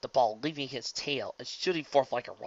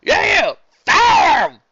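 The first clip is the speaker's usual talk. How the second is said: high, loud eager yell; cheering shout; 17 dB louder, much slower than usual. Acted. Fan cheering to fire the umpire, loud shout. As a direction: yell along with the crowd.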